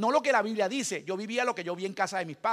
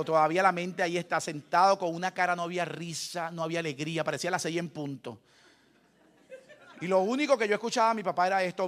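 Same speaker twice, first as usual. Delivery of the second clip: normal talking voice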